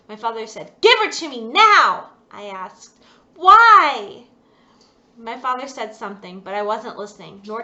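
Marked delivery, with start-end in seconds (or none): 0.8-2.1 loud, higher, furious order; an angry father's loud shout; angry command. Acted. Father demands the doll. 3.3-4.3 high, loud pleading why; a girl's loud, high protest; desperate cry. Acted. Girl protests to father.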